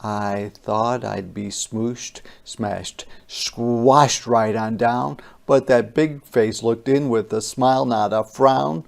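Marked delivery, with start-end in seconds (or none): none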